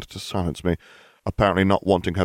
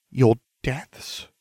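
weak, higher-pitched question; weakly; 6 semitones higher than usual. A narrator's reading. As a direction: ask faintly, taken aback. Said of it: "Missus Granger asked weakly".

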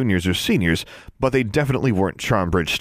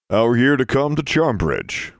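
put-on older cadet; 3 semitones higher than usual. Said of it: "said one of the other B M I boys".

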